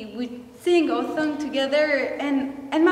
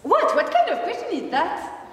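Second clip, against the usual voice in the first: indignant surprise; 5 semitones higher than usual. Acted. Indignant witness in courtroom.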